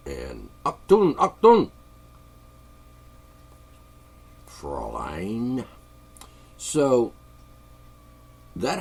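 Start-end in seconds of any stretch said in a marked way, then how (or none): 0.6-5.6 put-on barked Achtung then slow Fräulein in a movie German accent; mock German officer. Imitating a Bogart-film German officer, barking German accent. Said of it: "Humphrey Bogart movie".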